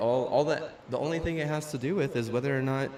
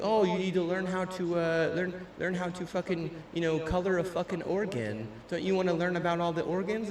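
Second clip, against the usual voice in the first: higher, sing-song mock of the teacher; 6 semitones higher than usual. Mocking his nutrition teacher, he parrots her in a higher sing-song voice. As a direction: parody the teacher's pitch.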